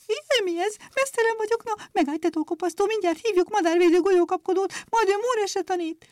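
very high, scared bird voices; frightened chirping sparrows. A narrator's reading. Plucked sparrows crying out in a high scared chirp. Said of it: "rémülten csipogtak".

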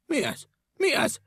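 high, rising alarmed cries; bewildered engine driver crying out; alarmed shouting. A narrator's reading. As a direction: yell in confusion. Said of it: "kiabált a mozdonyvezető".

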